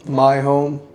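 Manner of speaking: loud relay of a scream, pitch held level; relayed spirit's scream. Retold or imitated. Medium relays the entity claiming the house, loudly. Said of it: "it was, like, screaming this".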